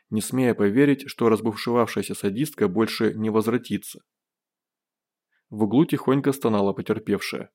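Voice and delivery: ordinary delivery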